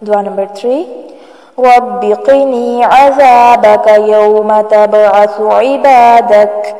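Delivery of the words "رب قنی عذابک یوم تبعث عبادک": slow, measured Arabic recitation of a dua. Shown as a demonstration. Teacher reciting a dua slowly for students. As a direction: recite each word slowly for learners.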